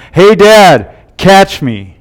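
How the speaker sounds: put-on a boy calling out high to his dad; child yelling. Son calling to dad from the rocks, a light mock yell. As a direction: shout it down from the cliff like an excited kid. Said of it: "I heard a voice from above me yell".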